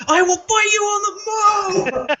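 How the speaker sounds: screamed way up high in a cracking howl; over-the-top mock rage, voice cracking at the top; Triple H meltdown. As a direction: lose it completely, shrieking the threat. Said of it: "like Triple H in the Wrestling Isn't Wrestling movie, just lost his shit".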